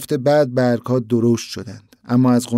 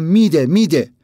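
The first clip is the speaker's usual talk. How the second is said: a mild narrated shout, a bit raised; 4 semitones higher, 4 dB louder than usual. A narrator's reading. Boy insists to his brother the flower will bloom, mild shout. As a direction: insist loudly, half-shouted. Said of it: "فریاد زدم".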